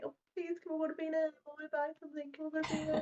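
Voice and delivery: high pleading teen voice, mock; begging teens. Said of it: "everybody was like".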